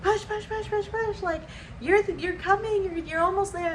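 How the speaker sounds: high-pitched chanting encouragement; cheering doctors. Retold or imitated. Doctors urging the mother in labor, high cheering chant. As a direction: egg her on like a crowd at the finish line.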